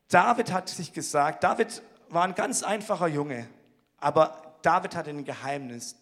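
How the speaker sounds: ordinary delivery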